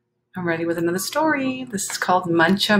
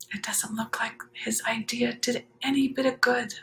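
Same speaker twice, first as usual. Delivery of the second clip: soft whisper to the listeners; whispered aside; 5 semitones higher, 5 dB quieter than usual. Real, not acted. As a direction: lean in and whisper it.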